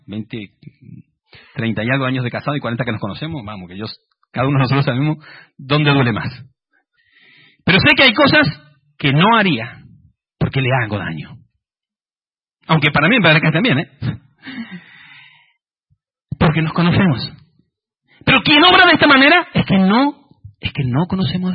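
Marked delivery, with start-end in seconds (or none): 0.1-3.9 dropped aside. Real, not acted. Aside about marriage, dropped voice. 18.2-20.3 rising preacher emphasis. Acted. Preacher rises to climax.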